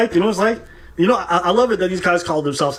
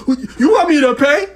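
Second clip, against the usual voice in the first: high, loud retold outrage; incredulous imitation, pitched up and loud; mock indignant disbelief; 8 semitones higher, 5 dB louder than usual. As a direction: play a customer shocked at the price. Voices shocked customer in story.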